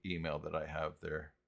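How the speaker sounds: ordinary delivery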